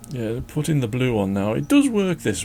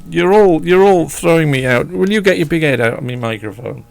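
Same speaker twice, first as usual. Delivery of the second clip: loud, high scold at the dogs; shouting at the pets; 5 semitones higher, 11 dB louder than usual. Real, not acted. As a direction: tell the dogs off loudly.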